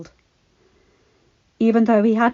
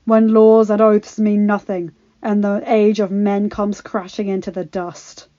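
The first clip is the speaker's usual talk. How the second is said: loud, grand storyteller opening; epic prologue narration; 7 dB louder than usual. A narrator's reading. Narrator opening the tale to listeners, grand and loud. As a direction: boom out the prophecy like a legend.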